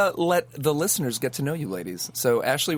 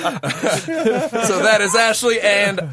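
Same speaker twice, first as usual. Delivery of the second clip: slow, laughing introduction; 7 semitones higher, 5 dB louder, slower than usual. Real, not acted.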